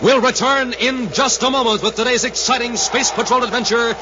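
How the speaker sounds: big, high, ringing announcer voice building to the hero's name; show announcer. Acted. Announcer opening the radio show for the listeners, high and ringing. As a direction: sell the adventure like a carnival barker. Announcer promises return, shouting.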